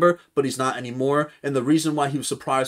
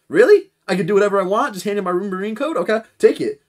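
much higher, louder delighted disbelief; mock eager Garp; 8 semitones higher, 8 dB louder than usual. Garp gleefully accepting the offer, much higher and louder. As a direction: play a kid told he can do anything. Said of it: "And he's like".

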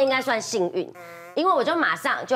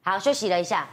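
ordinary talk, nothing marked

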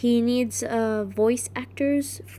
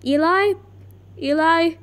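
loud, raised-pitch call; 5 semitones higher, 4 dB louder than usual. Real, not acted. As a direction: call the name out for attention. Calling out to the organizer.

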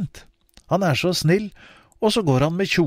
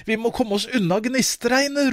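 higher, urgent call; 9 semitones higher, faster than usual. Acted. Mother calls the children away from the sparks, urgent. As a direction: hurry the family away from danger. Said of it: "roper mor".

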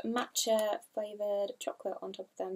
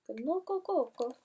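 Kinoko Koko with higher pitch, a lightly put-on Japanese-style accent; unsure put-on accent; 4 semitones higher than usual. Shown as a demonstration. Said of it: "Don't know what accent that was".

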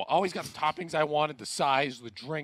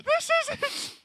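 very high, laughing through it; can barely get it out for laughing; real giggling disbelief. Real, not acted. Laughing at uncut pizza.

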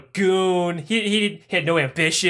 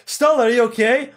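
calling out; 6 semitones higher, 5 dB louder than usual. Acted. Shaking unconscious Stalin awake.

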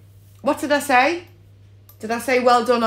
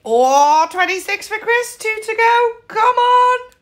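excited; 9 semitones higher than usual. Real, not acted. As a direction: get more thrilled each line. Rising excitement near win.

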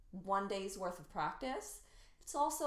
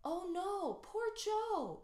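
much higher, caring pity; really worried about Joe; sincere concern; 8 semitones higher, slower than usual. Shown as a demonstration. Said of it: "you're really concerned about Joe".

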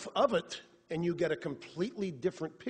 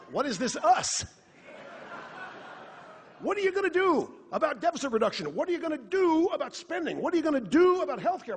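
loud, higher, exasperated questions fired at the screen; shouting at the TV; 7 semitones higher, 4 dB louder than usual. Retold or imitated. Host shouting at TV interviewer, loud and exasperated. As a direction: yell back at the TV host in disbelief. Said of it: "I'm shouting at the T V".

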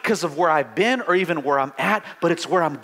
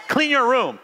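parent giving an order; 7 semitones higher than usual. Retold or imitated. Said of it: "parents when you tell your kids".

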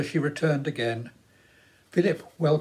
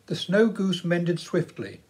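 plain reading voice

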